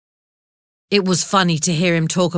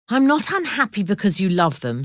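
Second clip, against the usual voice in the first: usual speaking voice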